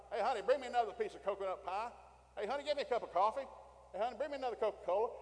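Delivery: loud, high holler to the wife; calling out orders. Acted. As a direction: shout fast demands toward the kitchen. Men calling wives for food.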